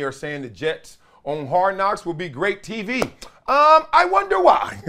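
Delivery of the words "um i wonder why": sarcastic wonder. Real, not acted. Sarcastic question.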